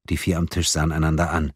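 ordinary delivery

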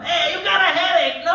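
put-on high, loud old Italian nonna; Italian grandmother. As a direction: shout remedies like a pushy grandma. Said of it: "My grandmother would chime in like".

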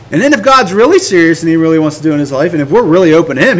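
ordinary talk, nothing marked

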